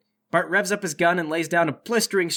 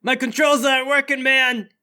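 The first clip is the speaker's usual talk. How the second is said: high, loud yell of frustration; Bart yelling; 7 semitones higher, 5 dB louder than usual. Acted. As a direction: shout it to the team, pitched up. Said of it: "Bart yells".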